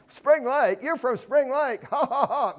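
high-pitched, incredulous jeer; hammed-up scornful disbelief with ha-ha-ha; mock disparagement. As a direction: ridicule the town, then fake a scoffing laugh. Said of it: "that's the sense of this".

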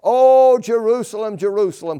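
a loud, high lament on "O Jerusalem"; anguished cry. Acted. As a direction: cry out over the city in grief. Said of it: "Notice, please, the emotion that is in this".

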